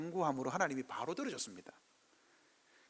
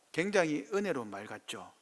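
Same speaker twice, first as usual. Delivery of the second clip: ordinary talk, nothing marked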